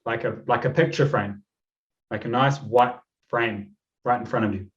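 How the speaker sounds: calm, unhurried meditation voice; measured relaxation-coach delivery; guided meditation. Acted. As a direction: guide them gently into the visualisation, leaving long pauses. Leads breathing and visualisation.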